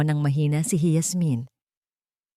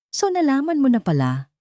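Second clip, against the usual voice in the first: mocking sneer; 8 semitones higher, 3 dB louder than usual. A narrator's reading. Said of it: "Iba na ang tono ng boses niya".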